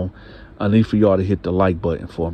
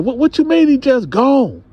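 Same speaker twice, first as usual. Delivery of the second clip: much higher and louder; incredulous anger; 5 dB louder than usual. Retold or imitated. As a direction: snap back in disbelief. Husband disbelieving.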